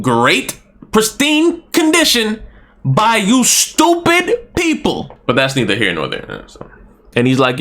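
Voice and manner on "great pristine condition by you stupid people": high, slow and sneering; mocking high voice. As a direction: drag it out with contempt. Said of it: "So he tells him".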